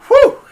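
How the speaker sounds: high, loud exhale of relief; loud relieved whew. Real, not acted. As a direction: blow out a big whew. Relieved exclamation.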